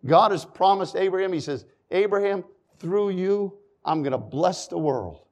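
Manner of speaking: ordinary delivery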